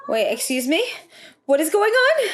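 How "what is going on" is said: shocked. Real, not acted. Shocked by a plot twist.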